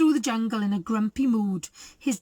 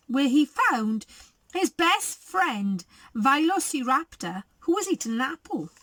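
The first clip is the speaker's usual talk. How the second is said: usual speaking voice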